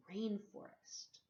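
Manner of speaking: usual speaking voice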